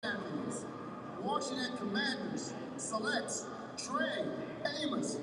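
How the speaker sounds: slow, building stadium announcement; draft pick announcement. Real, not acted. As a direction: build to the team name like an announcer.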